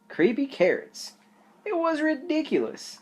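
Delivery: high, dismissive laugh-off; brushing off silly fear; scoffing rabbit. Acted.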